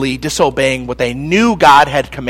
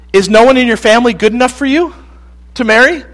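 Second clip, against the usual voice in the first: higher, louder exasperated parents; 6 semitones higher, 4 dB louder, faster than usual. Retold or imitated. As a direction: ask it like fed-up parents. Parents scold Samson.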